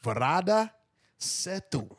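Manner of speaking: put-on Indian accent, names drawn out; rolling the name in an Indian accent. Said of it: "That's my Indian accent right there".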